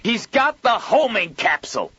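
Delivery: high, loud alarmed yell; panicked shout. Acted. Joel panics on phone.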